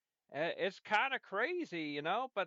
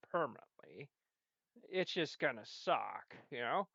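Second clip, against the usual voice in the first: ordinary talk, nothing marked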